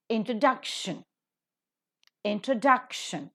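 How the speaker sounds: Introduction accented on DUC, said twice; stress before shun. Shown as a demonstration. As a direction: model intro-DUC-tion with a pause between repeats. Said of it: "The is given stress".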